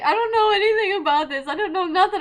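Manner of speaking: quick, high whine of denial, imitating a son; imitated son protesting. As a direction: play the kid pleading ignorance. Voicing her son's protest.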